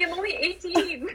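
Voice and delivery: high-pitched mock voice through laughter; playful imitation, breaking into laughs; laughing teasing quote. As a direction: tease in a high voice while laughing.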